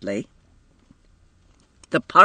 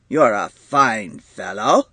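lower, slow hearty praise; a big man cheering up his son, lower and slower; jovial; 5 semitones lower, slower than usual. A narrator's reading. Father to his fallen son, slow jovial lower voice. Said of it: "he said jovially".

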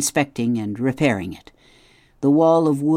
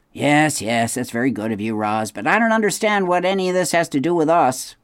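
put-on a higher, curt refusal from an old beaver; gruff beaver; 4 semitones higher, 4 dB louder than usual. A narrator's reading. Mister Beaver turns down the robot's gift, curt. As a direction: brush the offer off fast.

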